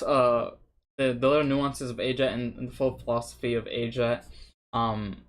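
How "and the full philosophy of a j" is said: ordinary talk, nothing marked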